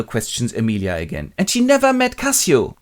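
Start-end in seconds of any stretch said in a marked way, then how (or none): 1.4-2.8 high, loud suspicious question; interrogating. Acted. Jealous husband questions Emilia, mock agitation. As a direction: press for an answer, overwrought.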